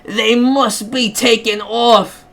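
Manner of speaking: high, indignant mock British voice; British accent. Caller demanding nasty games leave Steam, indignant mock British accent. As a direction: complain in an exaggerated UK accent, stretching it out slowly. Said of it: "I'm gonna just do U K".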